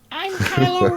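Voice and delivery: squeaky high mock villain voice; Kylo Ren voice. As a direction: pitch it way up as a joke Kylo. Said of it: "that's seriously how I imagine Kylo Ren to talk".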